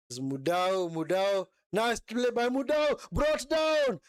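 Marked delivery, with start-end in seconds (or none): 1.7-4.0 rising to a yelp of protest; excited outcry. Real, not acted. Live reaction to a foul.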